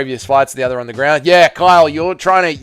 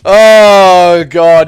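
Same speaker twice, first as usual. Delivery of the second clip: loud disgusted groan; 5 semitones higher, 11 dB louder than usual. Real, not acted. Disgusted outburst.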